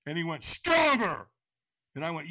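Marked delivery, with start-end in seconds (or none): 0.4-1.3 high, loud shouted demand; trainer's scream. Retold or imitated. Trainer demanding a bigger yes, screamed. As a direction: imitate a trainer screaming for more. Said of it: "he screamed at me".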